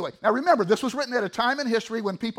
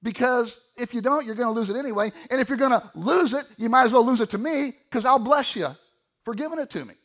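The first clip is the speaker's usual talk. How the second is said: lightly voiced appeal, higher and louder; God; 5 semitones higher, 6 dB louder than usual. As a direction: urge them insistently in raised, preaching voice. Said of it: "He says".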